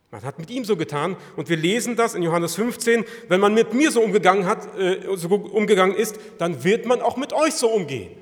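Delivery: loud, high, urgent preaching; preaching at full force. Real, not acted. Preacher pressing the congregation, loud and rising. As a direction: drive the questions home to the listeners.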